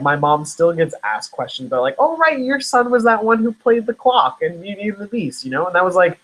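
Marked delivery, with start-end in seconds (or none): 2.0-5.4 high, bright imitation of a neighbour; gushing townsperson. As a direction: play the chatty local fan. Townspeople asking mom about him.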